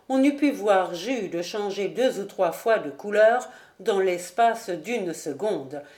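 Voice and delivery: ordinary delivery, nothing marked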